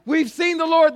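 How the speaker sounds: high, loud, joyful cry of news; excited announcement. Acted. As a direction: burst out with the good news. Disciples announce the risen Lord.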